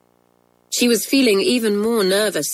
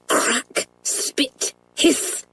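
sharp, voiceless crackle words; imitated firecrack noises; 7 semitones higher, much slower than usual. A narrator's reading. Firecracks going off in the fire, imitated crackle. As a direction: make each word sound like a spark.

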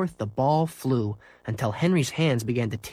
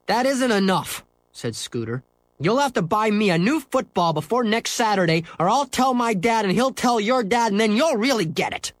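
put-on furious boy; 10 semitones higher than usual. Scooter demands a new ball, high angry rant.

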